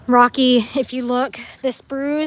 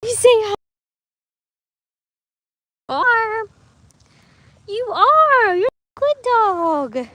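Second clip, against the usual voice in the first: pet talk; 8 semitones higher, 3 dB louder, slower than usual. Real, not acted. Owner praising her dog, high pet voice.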